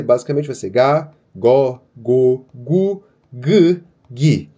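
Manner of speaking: "Gá, Gó, Gô, Gu" read one by one as clear syllables; reading syllables aloud. Shown as a demonstration. Teacher reads the G syllables aloud to the class. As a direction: sound out each syllable in turn. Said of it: "quando eu for ler".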